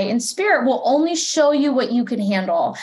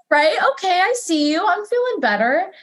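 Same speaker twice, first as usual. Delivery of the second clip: high, lifted, relieved greeting; delighted recognition; 7 semitones higher than usual. Retold or imitated. Seeing a rainbow shirt as a spirit sign, delighted. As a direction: greet the sign like an old friend.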